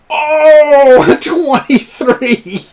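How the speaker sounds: high, loud whoop of surprise; thrilled outburst. Real, not acted. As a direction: stretch it out loud and high, delighted at the roll. Game master thrilled by high roll.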